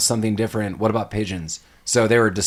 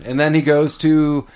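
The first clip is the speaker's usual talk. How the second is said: ordinary talk, nothing marked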